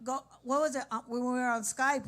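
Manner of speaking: usual speaking voice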